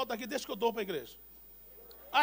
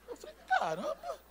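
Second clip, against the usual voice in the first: high, laughing caramba; laughing surprise. Real, not acted. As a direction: burst out amazed with a laugh. Astonished by driver's question.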